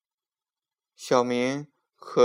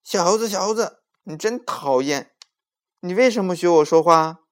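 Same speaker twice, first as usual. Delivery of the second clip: annoyed scolding; 6 semitones higher, 7 dB louder than usual. A narrator's reading. Boy scolding the monkey.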